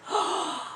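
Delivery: breathless unvoiced gasp of delight; a sharp little intake of breath; soft gasp. Real, not acted. Unwrapping the doll's face.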